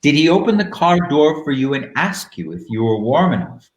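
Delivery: normal talking voice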